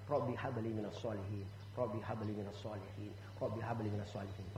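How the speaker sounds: low, soft prayer said three times; prayer recitation. Shown as a demonstration. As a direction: murmur the doa like a devout parent. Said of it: "Ini ngomongnya". (doa dibaca lirih dan rendah)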